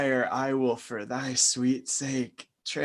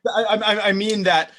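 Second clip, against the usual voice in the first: flustered; 5 semitones higher, 6 dB louder, faster than usual. Acted. Flustered protest.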